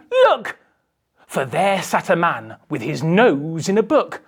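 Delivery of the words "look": very high, louder excited shout; a boy pointing and calling out in excitement; Peter crying look. Acted. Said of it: "Peter cried".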